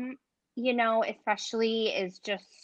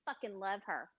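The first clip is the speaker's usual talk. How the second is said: Ruth character voice; 4 semitones lower, 13 dB quieter than usual. Acted. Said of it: "Do you like her?".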